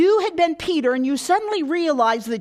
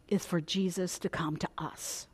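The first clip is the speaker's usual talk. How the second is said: whispered, softer; hushed whisper; 6 semitones lower, 9 dB quieter than usual. Acted. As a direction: confide it in a whisper. Preacher confessing what we want.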